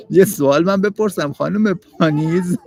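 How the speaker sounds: ordinary delivery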